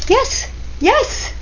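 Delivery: high, loud, thrilled cheer; delighted double yes; excited yes. Real, not acted. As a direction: cheer at the reveal. Excited reveal of the art.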